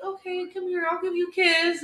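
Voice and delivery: put-on high little kid voice; imitated comforting son. Eli offering crying mom a kiss, imitated child voice.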